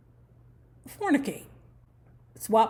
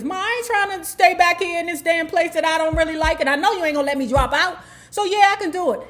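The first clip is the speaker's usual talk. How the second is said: louder and higher, rushing to reassure Ma; pleading fast, then a bright high yes; eager teen promise; 6 semitones higher, 4 dB louder, faster than usual. Retold or imitated. Teen assuring her mother she can handle it, eagerly.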